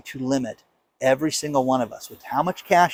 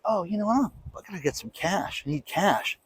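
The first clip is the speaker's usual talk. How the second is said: quiet inner thought; 4 semitones higher, 10 dB quieter than usual. Retold or imitated.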